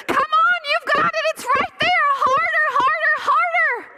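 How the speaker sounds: sustained high, loud urging; yelling parent. Retold or imitated. Parent running alongside a son near the finish, high yelling. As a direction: drive the runner on with insistent yells. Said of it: "yelling like the loudest, most embarrassing parent".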